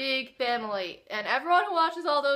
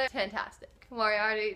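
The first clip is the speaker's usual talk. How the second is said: usual speaking voice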